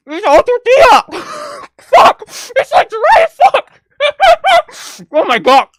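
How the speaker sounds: high, laughing wail of dismay; half laugh, half cry, at the disaster. Real, not acted. Laughing despair over the dry Crunchwrap.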